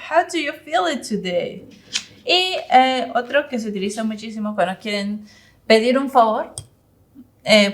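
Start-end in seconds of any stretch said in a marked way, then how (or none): 0.1-1.7 slow, higher-pitched model question; slow teaching model. Shown as a demonstration. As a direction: repeat it slowly for learners, pitched up. Teacher repeats model question for learners.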